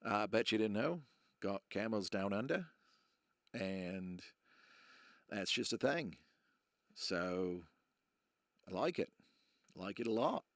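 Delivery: hammed-up Australian accent, not trying too hard; bad Australian accent. Quiz host showing off a bad Australian accent to viewers. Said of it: "That is my really bad Australian accent".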